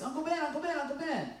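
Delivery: preacher imitating his nephew, high child voice; little six-year-old voice. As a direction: squeak it out like a small kid holding back. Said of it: "in his little six-year-old voice".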